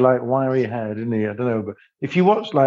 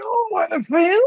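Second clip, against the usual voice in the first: very high, squeaky mock of the singer's Australian upspeak; slower than usual. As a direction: squeak it way up, taking the mickey out of the singer. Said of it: "I do".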